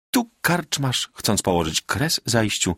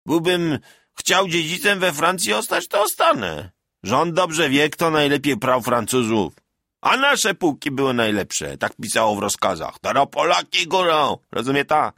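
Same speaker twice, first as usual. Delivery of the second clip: higher, rough-edged village bragging; boastful peasant soldier; 7 semitones higher than usual. A narrator's reading. Veteran Bartek bragging to the villagers, boastful. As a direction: crow about beating the French.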